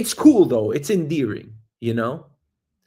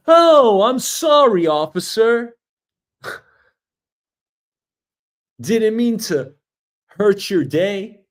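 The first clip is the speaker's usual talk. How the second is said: much higher, louder sugary apology; hammed-up sweet driver apologizing to cop; exaggerated polite apology; 6 semitones higher, 4 dB louder, slower than usual. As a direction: gush an innocent sorry to the officer. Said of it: "prim and proper".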